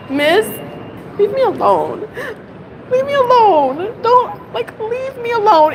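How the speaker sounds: mock exasperated. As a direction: imitate snapping at the teacher to back off. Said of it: "if you're saying".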